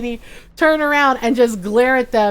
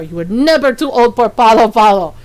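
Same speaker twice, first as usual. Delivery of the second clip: louder stern mother; stern Filipino mom, louder; mom's scolding; 4 semitones lower, 5 dB louder, faster than usual. Retold or imitated. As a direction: lay down the law to her grown son. Mom scolding son after spanking.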